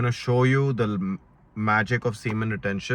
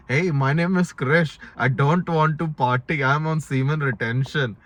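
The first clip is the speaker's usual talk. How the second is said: hammed-up Indian accent, higher and louder; 5 semitones higher, 4 dB louder than usual. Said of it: "If you do your proper Indian accent".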